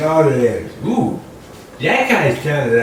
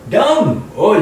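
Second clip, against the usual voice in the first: high, loud burst of realisation; hammed-up discovery shout; mock eureka; 9 semitones higher, 6 dB louder than usual. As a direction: blurt out dumb as if you just got it. Sudden comic eureka.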